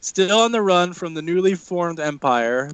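normal talking voice

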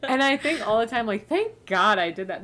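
loud, still giggling as you talk; laughing. Real, not acted. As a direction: carry the laugh into the sentence. Still laughing after own joke.

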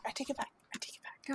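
breathy apologetic whisper. Real, not acted. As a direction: whisper it right in the mic.

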